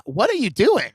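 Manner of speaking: usual speaking voice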